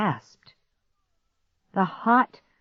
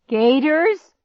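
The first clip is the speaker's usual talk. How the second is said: high, loud startled question; alarmed; 11 semitones higher, 7 dB louder than usual. Acted. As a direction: squeak it out in alarm. Clark alarmed at gator talk.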